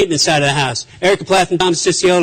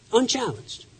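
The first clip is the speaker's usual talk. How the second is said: "Unchallenged." repeated much softer, dropping away; quiet echo; 5 semitones higher, 10 dB quieter, slower than usual. Real, not acted. Speaker letting the point settle, soft echo. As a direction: let "Unchallenged." sink to a hushed echo.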